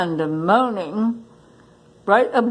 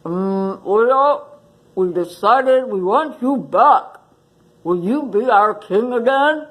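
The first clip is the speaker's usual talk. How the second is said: gruff water buffalo; 2 semitones higher than usual. Acted. Water buffalo asks Leo back as king, gruff. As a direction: clear the throat and ask gruffly. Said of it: "spoke in a gruff voice".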